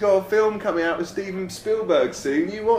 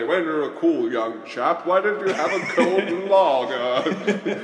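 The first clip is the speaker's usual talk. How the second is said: mock beer advert; slower than usual. Pitching lager to cool young men, hammed-up ad voice. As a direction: sell the lager like a cheesy commercial. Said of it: "do cool beer adverts".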